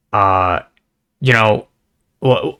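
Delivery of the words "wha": ordinary talk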